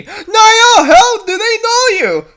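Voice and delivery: high, loud, slow amazement in a put-on voice; loud wondering high voice; astonished. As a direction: gape at them in disbelief. Character astonished by the recognition.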